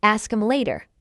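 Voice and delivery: quick, linked "Ask 'em later". Shown as a demonstration.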